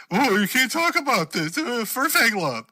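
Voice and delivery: high, put-on nerdy moderator voice; mock a prissy online mod, slowing at the end; mocking Discord mod. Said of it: "and be like".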